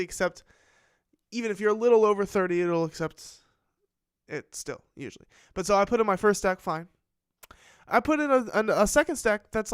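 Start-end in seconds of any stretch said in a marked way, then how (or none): 5.5-9.7 loud, excited storytelling to stream; telling the ATM story much too loud; blaring. Real, not acted. Streamer gets carried away retelling the ATM story, blaring. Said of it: "I'm fucking blaring here".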